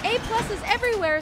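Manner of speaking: excited high yell. Real, not acted. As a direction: shout it out in delight. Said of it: "Sorry, I yelled that".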